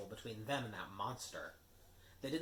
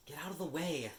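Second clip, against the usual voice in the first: snapping; 7 semitones higher, 5 dB louder than usual. Acted. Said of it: "snapped Ron".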